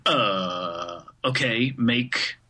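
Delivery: low, slow, blank-minded drone; slow dazed voice. Retold or imitated. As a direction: drag it out like staring at nothing. Imitating himself at blank page.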